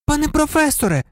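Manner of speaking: very high-pitched pupil's call; high, eager cry to the teacher. A narrator's reading. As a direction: call out urgently in a high girl's voice. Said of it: "крикнула Герміона".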